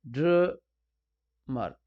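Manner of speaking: Drummer very slowly split as ड्र, then मर; two separate syllables. Shown as a demonstration. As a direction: break drummer in two with a long gap. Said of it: "दो syllable होने चाहिए".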